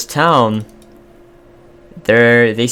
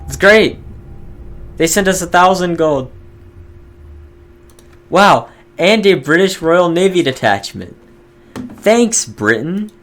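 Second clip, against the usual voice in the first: higher, louder excited cheer; real delight; 8 semitones higher, 4 dB louder than usual. Real, not acted. Gamer cheers as British aid arrives, excited delight. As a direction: celebrate the long-awaited aid.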